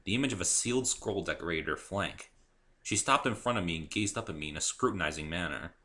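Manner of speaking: ordinary delivery, nothing marked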